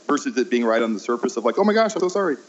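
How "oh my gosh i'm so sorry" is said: gushing apology pitched way up; over-apologetic. Retold or imitated. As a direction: gush the sorry much higher, all on the surface. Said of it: "me being over apologetic".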